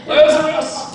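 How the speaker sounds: loud, high call to the tomb. Pretend. Jesus calling Lazarus out of the tomb, loud high call. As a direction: shout his name out to the grave.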